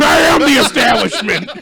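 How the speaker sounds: slow, loud grand declaration; pompous proclamation. Pretend. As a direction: announce it like a tyrant. Pompous comeback.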